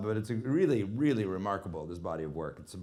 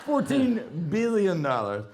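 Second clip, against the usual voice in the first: high, loud and slow, laughing in disbelief; amazed laugh; 9 semitones higher, 6 dB louder, slower than usual. Real, not acted. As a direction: stretch it out, astonished and laughing. Amazed at box office total.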